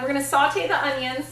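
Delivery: ordinary delivery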